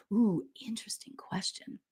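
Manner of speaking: unvoiced whisper of interest; intrigued whisper. Real, not acted. Reading a viewer's question, whispering back. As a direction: breathe it out, intrigued.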